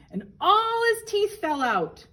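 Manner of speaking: high and loud, bursting with delight; storyteller bursting out with the big reveal; excited story climax. Acted. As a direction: make the kids gasp at the punchline. Story climax read to children.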